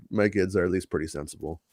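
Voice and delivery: usual speaking voice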